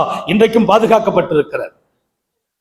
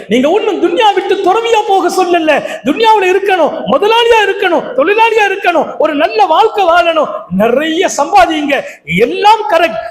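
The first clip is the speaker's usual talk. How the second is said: high-pitched, driving sermon voice; full-force exhortation; 10 semitones higher than usual. Acted. Preacher urges listeners to live in the world with God, full-force exhortation. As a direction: preach each point on a sustained high note.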